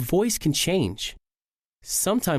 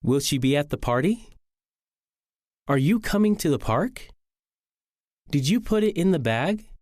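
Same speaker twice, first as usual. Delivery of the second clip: yes-no questions climbing on "party", "park", "bag"; rising intonation. Shown as a demonstration. Teacher reading yes-no examples, rising intonation. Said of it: "usually end with rising intonation".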